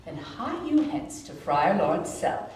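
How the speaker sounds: ordinary delivery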